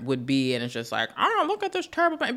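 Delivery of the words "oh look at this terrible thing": high, mocking gasp at a parent; mock judgy onlooker. Non-parent mocking a parent's harshness in a high voice. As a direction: sneer like a scandalized stranger.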